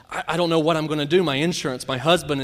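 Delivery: loud, fast, high panicked quote; retold hysterical screaming. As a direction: mimic the woman's frantic panic, rushing the words. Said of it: "She's just screaming".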